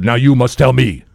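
earnest, insistent imitated African accent; in-depth African accent. Drunk African man demanding philosophy, earnest imitated accent. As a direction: press for wisdom in a mock African accent. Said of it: "it's just so in depth".

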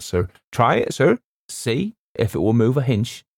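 servile working-class steward, put out; protesting steward. Acted. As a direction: grumble deferentially as a ship's steward. Voices steward Robert in dialect.

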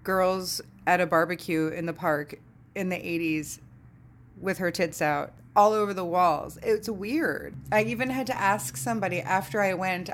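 normal talking voice

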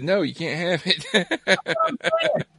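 high, slow, laughing mock refusal; mock band refusal. Imagining the band refusing the song, slow laughing mock refusal. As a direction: play the band turning them down. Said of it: "might have said".

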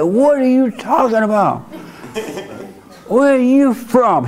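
high-pitched imitation of a confronting woman; mock outrage. Tall woman confronting the man over his insult, high mock outrage. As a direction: put on a high, indignant woman's voice.

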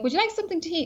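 a hint of an eager host offering food; friendly pub guy.

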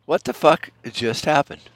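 as if staring at something absurd; bewildered what the fuck. Retold or imitated. Vlogger reacting to the news, baffled disbelief. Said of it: "I'm looking at it like".

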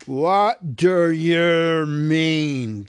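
very slow, loud troll voice; a troll holding a hobbit by the hair; suspicious troll. Acted. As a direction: Bert the troll demands an explanation. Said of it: "said Bert".

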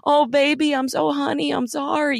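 mock the babying comfort; a hint of sarcastic pity; mock coddling. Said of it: "oh, everyone has to be".

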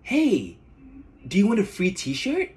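high, bright friendly call; chirpy campus recruiter offering a free shirt; pretty student's greeting. Retold or imitated. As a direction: play the cheerful girl handing out shirts. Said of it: "she approaches me and she says".

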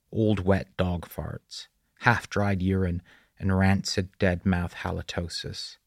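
usual speaking voice

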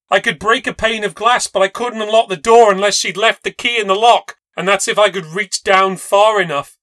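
racing through options in a panic; panicked. A narrator's reading. Panicked inner monologue, locked out.

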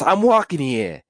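high, slow New York yell on walking; New York tough guy. Acted. Brownie yelling at a passerby, New York accent. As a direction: bark it at the street. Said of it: "it's, no, it's".

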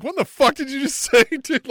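high, loud disbelief with a laugh; imitating a stunned, outraged reply; mock incredulous. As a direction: react in loud, laughing shock. Voicing stunned reaction to the confession.

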